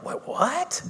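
high, rising mock-baffled question; incredulous squeak of a question, retold; acted disbelief. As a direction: play stunned disbelief, pitch jumping up. Retold disbelief at nurse's good news.

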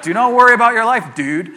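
loud, easygoing surfer-dude delivery; laid-back hippie. Shown as a demonstration. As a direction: toss it off like a chill hippie. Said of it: "the word "dude" at the end".

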